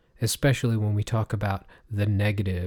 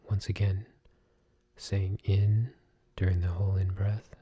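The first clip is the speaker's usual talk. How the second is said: guided meditation. Acted. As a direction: talk them gently through each breath. Leads breathing meditation.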